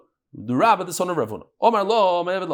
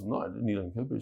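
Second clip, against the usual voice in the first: low, hushed mock denial; soft, dropped-voice reply in a retold quarrel; retold meek denial; 9 semitones lower, 12 dB quieter, faster than usual. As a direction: imitate a husband quietly denying it. Husband's denial in retold story.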